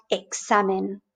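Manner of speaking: examen with K and S, no Z sound; Spanish X as KS. Shown as a demonstration. Teacher showing learners examen with X as KS. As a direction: say examen slowly with a crisp KS. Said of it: "in Spanish is K and S".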